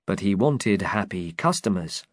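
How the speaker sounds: plain reading voice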